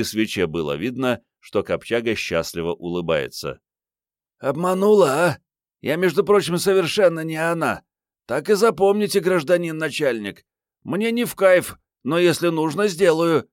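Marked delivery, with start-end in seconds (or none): 4.4-13.5 put-on high, teasing young woman's voice; дерзкая героиня книги. A narrator's reading. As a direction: tease the boss, pitched right up high. Героиня дразнит командира. (высоким девичьим голосом, задорно поддразнивая)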